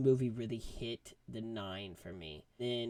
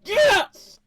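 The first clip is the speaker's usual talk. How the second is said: very high, loud triumphant yes; high loud victory shout; cheering; 14 dB louder than usual. Retold or imitated. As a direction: cheer like in the theater. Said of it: "I was like".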